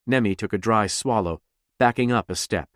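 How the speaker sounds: plain reading voice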